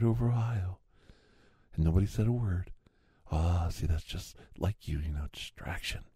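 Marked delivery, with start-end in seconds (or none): none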